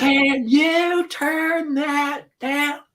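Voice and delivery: put-on very high, very slow drawn-out kid voice; exaggerated son. Son asks again over the music, hammed-up slow kid voice. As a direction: stretch it out like a whiny boy. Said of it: "He'll be like".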